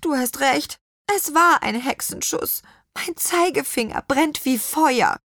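rueful witch; sighing. A narrator's reading. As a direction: sigh and own up to a sore finger. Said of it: "Petronella seufzte".

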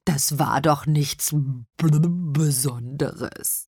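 slow, bubbly fish voice; embarrassed old carp; bashful carp. A narrator's reading. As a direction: burble modestly, slowly, with a blub mid-sentence. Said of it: "wehrte Bommel verlegen ab".

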